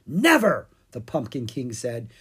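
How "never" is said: high, loud refusal; emphatic refusal. Acted. Pumpkin King refuses to let the dragons go, emphatically. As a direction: cry it out as an outraged king.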